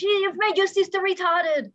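high, loud put-on voice of a scolding mother; imitated angry mother. Mother scolding daughter over fallen sister, high put-on voice. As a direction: play the furious mother snapping at her daughter. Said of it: "she pulls my sister and goes".

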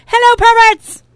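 high, loud call to the audience; crowd greeting. Real, not acted. Cohost greeting the studio audience, loud call. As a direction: hail the crowd.

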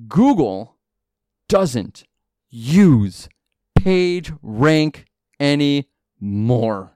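each word slow and punched with long pauses; Google, doesn't, use, PageRank, anymore, one by one; dramatic pauses. Shown as a demonstration. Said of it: "drum roll".

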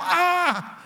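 short high yelp of pain; mock pained yelp. Father kneed in the back by his son, high yelp. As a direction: yelp like a knee just hit your back. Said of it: "and I yell".

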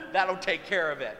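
loud dragon boast; booming mock-heroic assurance; eager dragon volunteer. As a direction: promise it at full volume, cocky. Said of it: "one of those dragons would be like".

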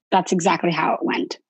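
normal talking voice

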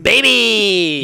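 mock self-imitation, high and very loud; exaggerated catchphrase shout. Co-host mimicking how others portray him entering rooms, exaggerated shout. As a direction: blare it out long, falling at the end. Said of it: "made it sound like every time I walk in".

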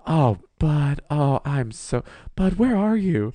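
a touch of a high, worried friend; mock worried roommate. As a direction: fuss over him. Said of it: "she's like".

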